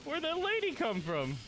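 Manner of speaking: high, slow mock-confused voice; baffled NPC. Streamer voicing a startled game character, hammed-up high and slow. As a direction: pitch way up and stretch out the shocked question. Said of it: "And that guy's like".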